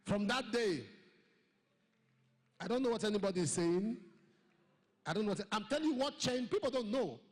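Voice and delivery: loud, driving preacher's testimony; fervent loud pulpit preaching; preaching at full force. Acted.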